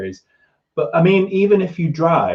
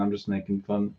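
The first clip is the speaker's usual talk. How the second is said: ordinary delivery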